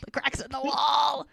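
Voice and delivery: high, playful mock shout; emphatic cry. Podcaster cheering the heroine's softening, mock shouting. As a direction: call it out, hammed-up, stretching WALL. Repeats line in excited emphasis.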